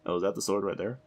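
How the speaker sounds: ordinary delivery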